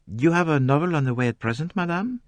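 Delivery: put-on polite Belgian-French detective accent; courteous French-accented reply; gentle French accent, very polite. A narrator's reading. Poirot inquires.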